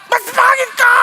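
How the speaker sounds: put-on loud, high childish retort; mock shouted comeback. Preacher acting out a petty comeback, shouting high. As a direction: yell it back like a hurt kid trading insults.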